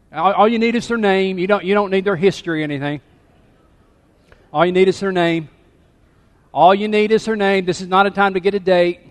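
playfully scolding them, loud and high; calling out. Real, not acted. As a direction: call over a chattering congregation. Calling over chatting congregation.

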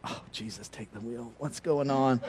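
desperate prayer. Retold or imitated. Stranded with a dead battery, hushed prayer. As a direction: mutter it under your breath to God. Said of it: "now I'm like".

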